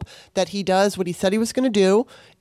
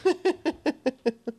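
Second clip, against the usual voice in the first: self-aware laugh; 7 semitones higher, 5 dB quieter than usual. Real, not acted. Laughs at her own fervour.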